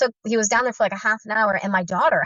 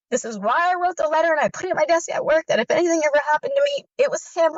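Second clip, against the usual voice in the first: higher, tense inner voice, as she remembers it; retold terrified thought; 7 semitones higher than usual. Wife saying goodbye in her head before being killed, high frightened retelling. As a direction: think it as if these are your last seconds. Thinks she is about to die.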